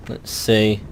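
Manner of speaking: ordinary talk, nothing marked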